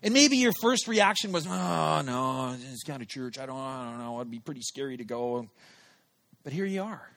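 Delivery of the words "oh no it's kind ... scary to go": low, soft mumbled excuses; reluctant hedging. Retold or imitated. Invited to church at the theater, mumbling reluctantly. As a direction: trail downward into reluctant mumbling. Imagined reluctant first reaction.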